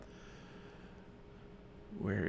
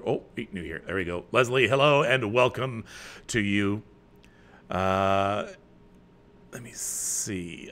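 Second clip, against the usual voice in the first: ordinary delivery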